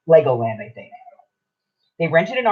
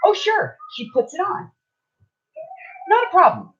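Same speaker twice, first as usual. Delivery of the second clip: very high, airy mock agreeableness; mock breezy. Mimicking the clerk accepting Oogie on a hat, mock breezy. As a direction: sing it out high as if nothing could be simpler.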